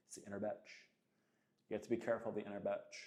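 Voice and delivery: soft, quick sneer of a whiny inner voice; lightly voiced whiny inner weakling; inner bitch voice. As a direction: voice the lazy inner self, half-joking. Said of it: "imagine your inner bitch talking like that".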